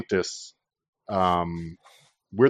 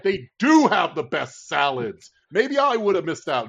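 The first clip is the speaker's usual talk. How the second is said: mocking libs; 6 semitones higher than usual. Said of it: "they're like".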